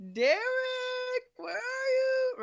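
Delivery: loud, high, stretched-out holler; loud and obnoxious. Retold or imitated. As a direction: call out obnoxiously for a friend, stretching it. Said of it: "I'm just being loud and obnoxious".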